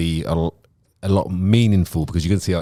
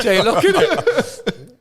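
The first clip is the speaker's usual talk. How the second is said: laughing; 12 semitones higher, much slower than usual. Real, not acted. Joking about the reversed name.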